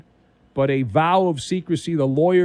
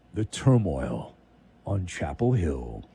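much lower, slow doom voice; ominous; 11 semitones lower, 5 dB quieter than usual. Acted. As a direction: drop into a low, ominous movie-trailer tone. Ominous aside on the scandal.

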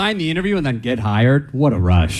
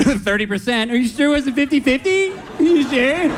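teasing, pitched much higher, sing-song ribbing; mocking coworkers; 11 semitones higher than usual. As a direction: needle him like a smug coworker. Coworkers teasing him at work.

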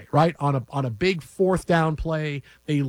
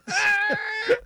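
high shrieking laugh. Real, not acted. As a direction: let out a shrill laughing shout. Laughing at his own story.

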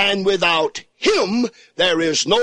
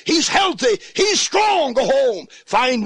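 rising shout; 6 semitones higher than usual. Acted. Preacher climaxing on the healing.

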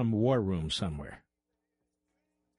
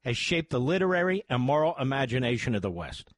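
higher-pitched, emphatic radio ad read; sponsor ad read; 7 semitones higher than usual. Acted. Host pitching a free college course to radio listeners, ad read. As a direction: sell it with measured, rising emphasis.

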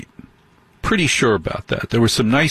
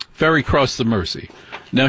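ordinary delivery

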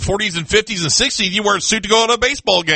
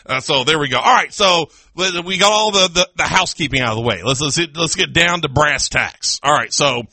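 ordinary talk

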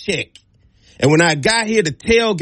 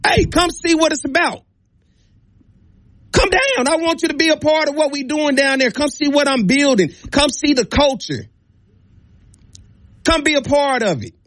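higher-pitched, eager sales pitch to a host; enthusiastic invitation; 5 semitones higher than usual. Acted. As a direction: pitch the visit as the coach would, voice lifted. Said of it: "By just saying".

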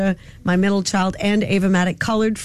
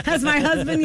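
ordinary delivery

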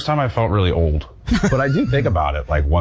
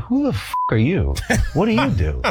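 incredulous; 5 semitones higher than usual. Retold or imitated. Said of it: "And I'm like".

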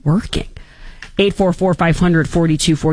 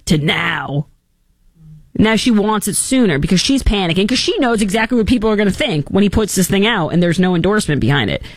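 ordinary talk, nothing marked

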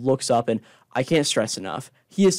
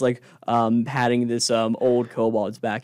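usual speaking voice

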